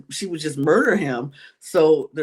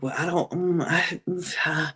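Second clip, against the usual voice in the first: imitating halting, softer hesitation; wavering, about to fold; 5 dB quieter than usual. Player pressed to reveal the name, hesitant and wavering. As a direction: stall between words as if about to give in. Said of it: "he was about to fold".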